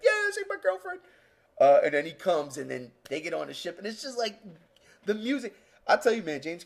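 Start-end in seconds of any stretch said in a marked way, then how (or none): 0.0-1.0 put-on high, bright cheering kid voice; excited kid. Boy rooting for Newt on screen, high excited call. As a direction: squeal it like a kid with a crush. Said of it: "so I was like".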